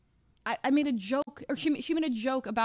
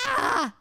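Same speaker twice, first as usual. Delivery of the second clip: sudden yelp; 12 semitones higher, 7 dB louder than usual. Real, not acted. Outburst ending the rant.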